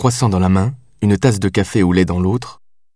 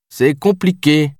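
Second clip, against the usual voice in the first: slow, higher, evasive answer; 7 semitones higher, slower than usual. A narrator's reading. Lieutenant dodging the captain's question about his mother, evasive. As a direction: draw it out to dodge the question. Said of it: "fit-il d'un ton évasif".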